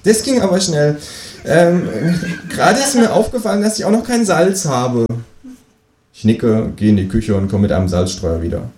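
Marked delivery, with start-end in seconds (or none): 0.0-5.0 much higher cheeky kangaroo voice. Acted. Kangaroo asking the narrator for eggs, much higher voice. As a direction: play the new neighbour kangaroo, pitched far up.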